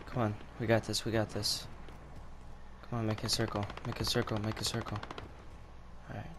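quiet urgent coaching; hushed urgent orders. Real, not acted. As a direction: keep it low so enemies won't hear.

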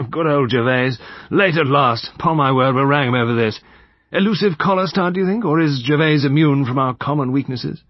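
put-on hearty, jovial elderly gentleman; genial old soldier. A narrator's reading. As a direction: rag an absent friend good-humouredly. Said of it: "He laughed genially".

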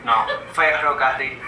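"ferrocarril" with weak, unrolled R sounds; can't roll R. Real, not acted. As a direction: say "ferrocarril" with the R softened toward a W or L. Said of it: "Yo no puedo decir la R".

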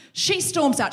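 normal talking voice

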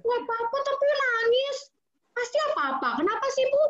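don't accept her answer, press hard; pushy disbelief. Shown as a demonstration.

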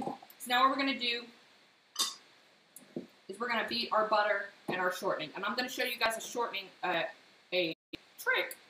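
ordinary talk, nothing marked

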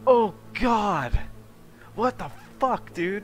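yelling out in disbelief; shocked yell. Real, not acted. Shocked at sudden game twist.

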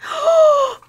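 high, loud gasp of surprise; shocked gasp. Real, not acted. Streamer opens surprise gift, shocked gasp. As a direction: gasp in real amazement. Sees the gift for first time.

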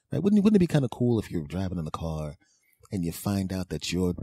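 ordinary talk

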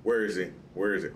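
ordinary talk, nothing marked

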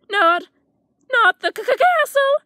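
high, stammering cry of fright; terrified stammer. A narrator's reading. Frightened dragon stammering that the castle terrifies him. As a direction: stutter it out in rising panic. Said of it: "he cried".

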